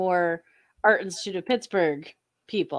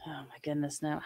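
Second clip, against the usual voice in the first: usual speaking voice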